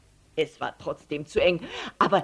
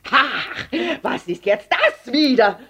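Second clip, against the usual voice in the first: higher, loud, irritated cry; exasperated outburst; 6 semitones higher, 8 dB louder than usual. A narrator's reading. Herr Letscher holding something blue, exasperated outburst. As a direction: burst out in annoyed bafflement.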